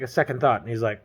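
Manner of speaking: ordinary talk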